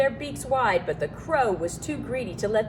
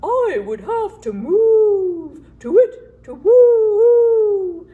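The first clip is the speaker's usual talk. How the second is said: slow, higher and much louder hooting owl; 12 semitones higher, 11 dB louder, much slower than usual. A narrator's reading. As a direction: hoot it out like an owl. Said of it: "said the owl".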